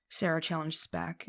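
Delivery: ordinary delivery, nothing marked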